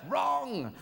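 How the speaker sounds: high sharp game-show wrong; mock buzzer-like correction. Comedian to Aristotle, high mock quiz-show correction.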